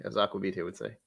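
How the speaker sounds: usual speaking voice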